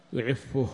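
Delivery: يعفه with a "u" after the doubled f; damma on the fa. Shown as a demonstration. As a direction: say يعفه as yu'iffuh, "-ffu-" before the final h. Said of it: "يجوز الضم اتباعا لحركة الهاء". (يعفه بضم الفاء والوقف على الهاء)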